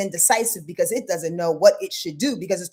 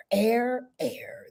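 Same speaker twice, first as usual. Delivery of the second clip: whispered; 4 dB quieter than usual. Real, not acted. Host closing the chant in a whisper. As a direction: breathe the word out.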